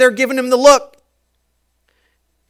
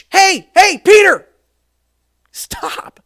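loud, higher calls to cut in; sharp interruption; 8 semitones higher, 5 dB louder, slower than usual. Acted. Jesus cuts off Peter's doubting, loud higher calls. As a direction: stop him mid-thought.

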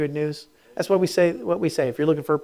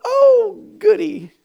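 sarcastic; 7 dB louder, much slower than usual. Acted. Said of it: "sarcastic aside".

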